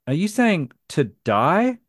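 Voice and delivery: slow question with TODAY heard as "to die"; puzzled listener, slowly asking "to die"; puzzled repeat. Retold or imitated. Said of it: "And they would say".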